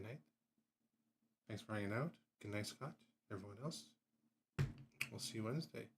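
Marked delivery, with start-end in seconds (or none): none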